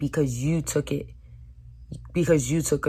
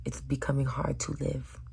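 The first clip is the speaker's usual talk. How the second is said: hushed whisper; 9 dB quieter than usual. Retold or imitated. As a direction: whisper it despairingly. Whispered retold despair.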